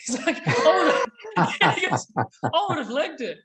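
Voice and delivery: high voice breaking into laughter; real laughter through the words; laughing while quoting. Real, not acted. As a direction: crack up telling the punchline. Cracking up at the punchline.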